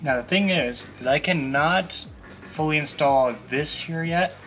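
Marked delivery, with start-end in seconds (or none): none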